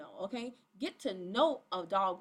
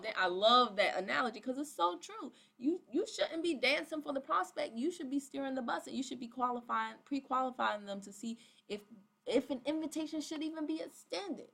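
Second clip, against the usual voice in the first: usual speaking voice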